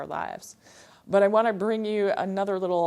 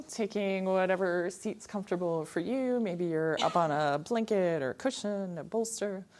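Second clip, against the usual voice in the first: ordinary talk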